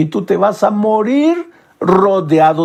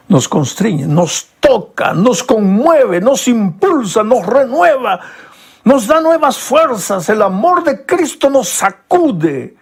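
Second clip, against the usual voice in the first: preaching build; 6 semitones higher, slower than usual. Acted. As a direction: build each line like a rising wave. Preaching builds on God's love.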